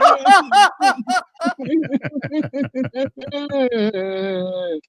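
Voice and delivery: long high hearty laugh. Real, not acted. Laughing at friends mourning him, a long hearty laugh. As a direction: crack up laughing hard.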